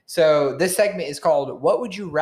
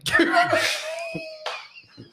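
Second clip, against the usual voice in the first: shrieking laugh. Real, not acted. Laughing at the joke.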